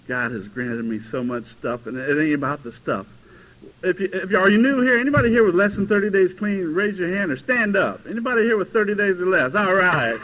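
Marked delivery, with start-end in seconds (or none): 3.8-10.2 calling out. Real, not acted. Calling to newcomers in room.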